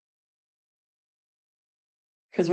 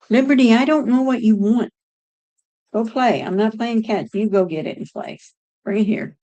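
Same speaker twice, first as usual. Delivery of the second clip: calling the dog; 4 semitones higher, 3 dB louder than usual. Real, not acted. Talking to the dog.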